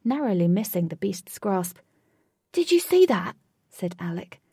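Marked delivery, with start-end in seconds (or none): 2.5-3.4 excited. Acted. Alec excited.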